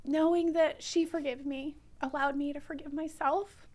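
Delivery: high, tearful voice; really fighting back tears; choked up. Real, not acted. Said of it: "I get choked up".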